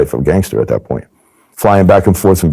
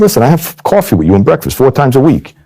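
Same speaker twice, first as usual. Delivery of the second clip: higher, exasperated scolding; indignant insistence; 8 semitones higher than usual. Retold or imitated. Agent scolding the mobster to cover himself: high exasperated insistence. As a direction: turn the blame around on him.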